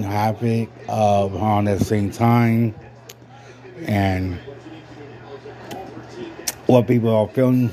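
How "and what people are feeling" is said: words around a sip; talking mid-drink; while sipping a drink. Real, not acted. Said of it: "Sorry, I'm drinking right now".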